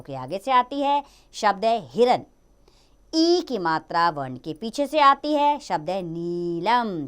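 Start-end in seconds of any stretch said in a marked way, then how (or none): 5.6-7.1 नीलम said slowly with the ई held long; long ई drawn out. Shown as a demonstration. Teacher showing children the long ई in नीलम, drawn out slowly. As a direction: stretch the ई in नीलम, slow and clear. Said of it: "खिंचाव होगा इसमें".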